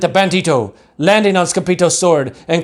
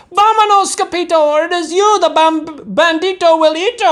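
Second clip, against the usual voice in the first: panicked cry. A narrator's reading. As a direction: yelp it in high panic. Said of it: "they cried".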